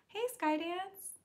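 put-on kid voice. Kid voice continues.

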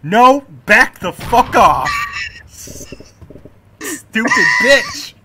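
screaming, very high and loud; furious shout. Real, not acted. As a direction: scream them off the farm. Yelling at farm intruder in game.

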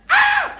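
very high loud shriek; hammed-up horror-movie shriek; horror scream. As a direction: scream in mock terror.

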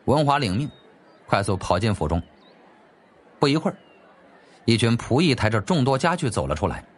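usual speaking voice

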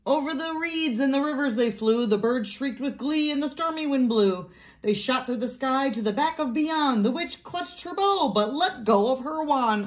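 lively, sing-song story reading; picture-book narration. A narrator's reading. Teacher reads Room on the Broom aloud, animated storytelling. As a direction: read it out with storybook drama, building to the mishap.

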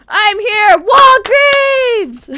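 high, very loud shout; calling out. Real, not acted. Player shouts to lost friend Walgreens, loud high call. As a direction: yell to be found.

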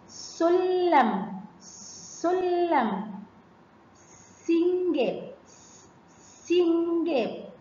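سلم and سنجاب stretched very slowly; drawn-out sounding out. Shown as a demonstration. Teacher lets pupils hear seen, سلم and سنجاب slowly drawn out. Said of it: "تعالوا نسمع صوت السين".

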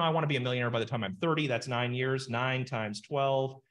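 ordinary talk, nothing marked

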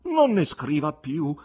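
slow, imploring; a slow, earnest plea; pleading voice. A narrator's reading. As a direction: beg him to stop writing. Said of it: "con voce implorante".

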